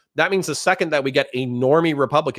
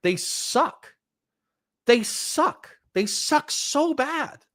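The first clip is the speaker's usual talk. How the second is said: each repeat climbing higher, last one drawn out; exasperated venting; 11 semitones higher, much slower than usual. Real, not acted. Streamer venting about people he hates, exasperated climbing pitch. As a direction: whine it higher and higher, stretching the final one.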